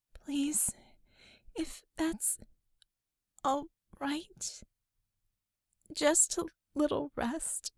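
soft high pleading whisper; timid whisper. Acted. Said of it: "she whispered".

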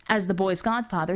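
plain reading voice

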